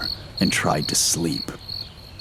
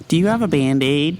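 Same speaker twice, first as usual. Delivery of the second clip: high, timid little voice; meek ghost; 8 semitones higher, 3 dB louder than usual. A narrator's reading. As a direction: drop the spook and ask sheepishly, high. Ghost's comic question.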